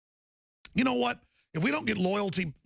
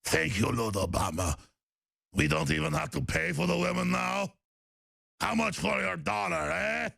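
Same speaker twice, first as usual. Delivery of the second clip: mock jihadist voice. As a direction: ham up a grateful terrorist. Voicing grateful jihadists.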